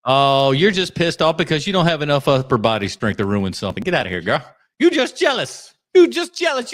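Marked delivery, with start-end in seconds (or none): 4.8-5.7 teasing. Acted. Teasing the co-host. 5.9-6.7 higher, louder taunt; sing-song teasing jab. Acted.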